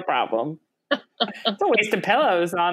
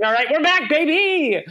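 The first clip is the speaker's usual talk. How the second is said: excited; 9 semitones higher, 4 dB louder than usual. Retold or imitated. Excited retold self-quote.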